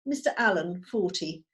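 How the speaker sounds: ordinary talk, nothing marked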